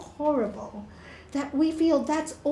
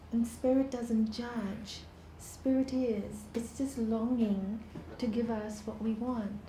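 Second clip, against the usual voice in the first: guided meditation; 3 semitones lower, 10 dB quieter than usual. Acted. Teacher guiding listeners through breathing, softly. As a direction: lead them gently into stillness.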